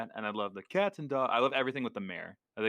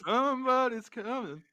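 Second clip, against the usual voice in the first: film quote impression; 13 semitones higher than usual. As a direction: tease it like a spooky singsong. Quoting film line in voice.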